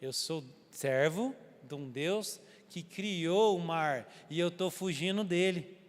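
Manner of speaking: slow, grave admission; solemn confession. Retold or imitated. Jonah confessing to the sailors who he serves, slow and grave. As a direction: let each word weigh.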